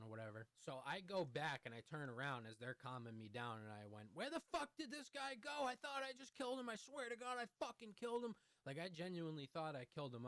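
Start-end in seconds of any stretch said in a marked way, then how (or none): 4.1-8.4 high-pitched panicked disbelief, acted out; freaking out. Teller relives losing sight of the man, freaking out in high voice. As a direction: re-enact the panic, voice jumped way up. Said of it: "I'm freaking out".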